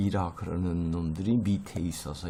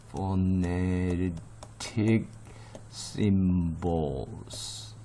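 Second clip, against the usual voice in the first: phonetic symbols drawn out very slowly, syllable by syllable; slow pronunciation demo; much slower than usual. Shown as a demonstration. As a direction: stretch each part of phonetic symbols, pausing between the words. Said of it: "발음을 어려워하는 바람이". (phonetic symbols를 음절마다 길게 늘여 천천히)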